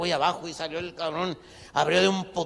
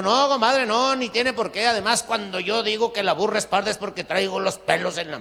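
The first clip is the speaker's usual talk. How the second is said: loud, higher, hurried reassurance; loud earnest insisting; 6 semitones higher, 6 dB louder than usual. Acted. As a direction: insist loudly to calm him. Compadre calms the armed friend. (insistencia fuerte y aguda)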